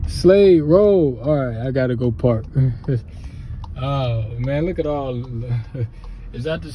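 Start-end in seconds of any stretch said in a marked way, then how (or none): 0.1-1.2 excited shout. Real, not acted. Excited arrival at family road.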